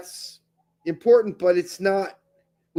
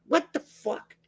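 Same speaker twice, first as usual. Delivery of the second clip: baffled; 9 semitones higher, 4 dB quieter than usual. Real, not acted. Confused by quote again.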